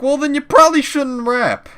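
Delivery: very high, loud shrill mockery; mocking the friend. Friend sneers he shouldn't rap, mocked very high and loud. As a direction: shriek it up high and loud to ridicule her.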